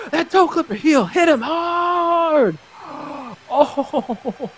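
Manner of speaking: high, slow and loud, thrilled; stretched-out victory cry; excited. Real, not acted. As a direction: savour the knockout out loud. Celebrating knockout.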